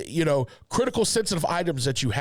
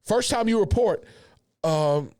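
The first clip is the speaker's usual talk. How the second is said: ordinary talk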